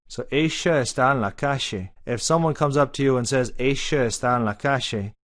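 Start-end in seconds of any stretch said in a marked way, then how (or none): 0.3-1.9 Argentine Spanish, ll as sh in Ella and calle; Argentine accent. Shown as a demonstration. Teacher demonstrating the Argentine sh sound. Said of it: "The double L is a sh". 3.6-5.1 Argentine Spanish, Ella said esha, calle said kashe; Argentine accent. Shown as a demonstration. Teacher shows how an Argentine says it. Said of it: "either Argentine or studied".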